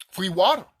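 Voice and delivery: loud, hammering demands with a hard final done; furious rant. Real, not acted. Angry rant about poisoned food.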